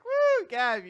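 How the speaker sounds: high, loud cheer. Retold or imitated. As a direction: whoop for the hero. Cheering Gav.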